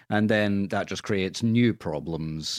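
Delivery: ordinary talk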